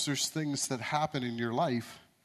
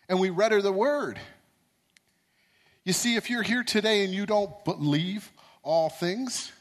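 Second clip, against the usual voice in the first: rising preacher's fervour, louder; preaching; 6 semitones higher, 4 dB louder than usual. Acted. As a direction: preach it, building to a climax. Preaches the turnaround story.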